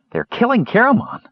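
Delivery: high-pitched, panicked cry; high, distressed exclamation; desperate alarm. A narrator's reading. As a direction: blurt it out in alarm for a friend. Kender desperate as friend is choked.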